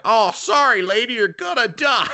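loud, high, hammed-up warning; over-the-top bad-English battle shout; bad anime English. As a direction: holler it like a badly dubbed anime fighter. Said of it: "they do English, and it's really bad".

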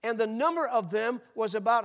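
preacher reading Acts aloud to the crowd; preached scripture. Acted. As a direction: proclaim the verse with fire.

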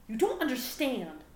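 high, loud indignant outburst; indignant yell. A narrator's reading. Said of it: "Gohan yelled indignantly".

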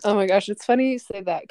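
ordinary delivery

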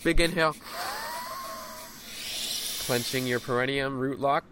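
energetic cue then softening to exhale; guided breathwork cues. Acted. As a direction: coach the breath hold, then ease off. Cues inhale, hold, exhale.

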